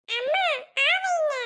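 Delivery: cooing high at the little ones. Real, not acted. Coaxing babies to open mouths.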